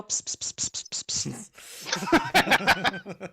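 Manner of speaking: repeated unvoiced psst to beckon an animal; cat-calling psst. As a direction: coax it over with hissed pssts. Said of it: "can I go over here and go".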